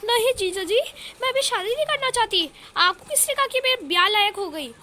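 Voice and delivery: high, shocked protest. Acted. Shakuntala refusing marriage to her brother-in-law, shocked and high. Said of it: "सदमे में हो जाती है".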